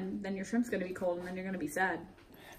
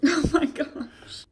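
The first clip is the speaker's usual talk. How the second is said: laughing; 7 semitones higher, 8 dB louder, much slower than usual. Real, not acted. Cracking up at the Hitler joke.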